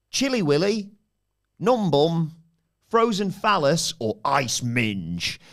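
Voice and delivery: slow, drawn-out game-show options; quiz host voice. Acted.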